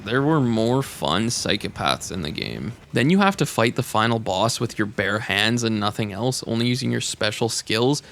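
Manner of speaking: ordinary delivery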